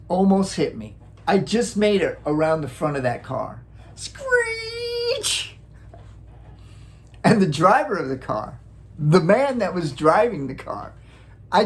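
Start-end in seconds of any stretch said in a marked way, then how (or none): 4.0-5.6 tire screech. Retold or imitated. Imitating car brakes again.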